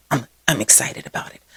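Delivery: quiet hushed whisper; whispered confession. Real, not acted. Vlogger admitting bike excitement in a whisper. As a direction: whisper it like a secret. Whispered aside about bikes.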